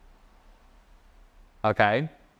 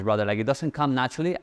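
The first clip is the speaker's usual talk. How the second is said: ordinary talk, nothing marked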